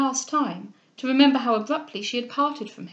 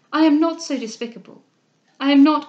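loud, hurt protest; indignant protest; 4 semitones higher, 10 dB louder than usual. Acted. Cecilia defending herself to Mrs Delvile, loud hurt protest. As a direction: push back hard, voice rising.

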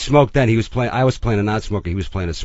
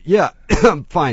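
mock choking cough, louder and higher; imitated coughing fit; 8 semitones higher, 7 dB louder, slower than usual. Coughing extra insisting to the star that he's fine, hammed-up splutter. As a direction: splutter it out slowly between coughs. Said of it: "starts coughing".

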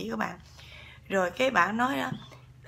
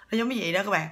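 ordinary delivery

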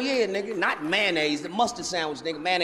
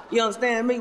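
usual speaking voice

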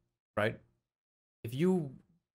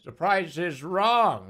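Bob Barker; 3 semitones higher, 4 dB louder, much slower than usual. Retold or imitated. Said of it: "right when Bob Barker says".

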